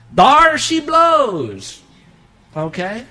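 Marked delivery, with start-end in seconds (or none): 0.1-1.8 high, loud and dragged out slowly; a sudden slow bellow; explosive eruption. Acted. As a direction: let it blow like a volcano. The pent-up anger finally erupts.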